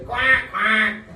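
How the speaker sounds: high, loud quack quack like a duck. Retold or imitated. The duck answers the students, loud quacking. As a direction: quack back like the puppet duck. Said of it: "And then they say".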